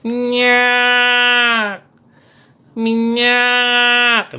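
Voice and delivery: long held high street call; shrill kerosene-seller cry. Retold or imitated. Kerosene vendor hawking door to door, piercing drawn-out cry. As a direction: sweep up high and hold it, loud. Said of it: "suaranya melengking, khas banget".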